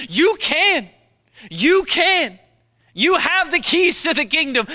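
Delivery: loud, high, rising sermon delivery, hammering each word; full-force preaching. Acted. Pastor urging the congregation, fervent preaching. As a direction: preach it to the back row.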